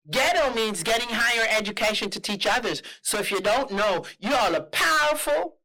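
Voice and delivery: put-on emphatic, high Southern lady's accent; Atlanta, Georgia accent. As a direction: proclaim it as an imitated Georgia woman. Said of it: "I'm gonna try to do the accent for you guys".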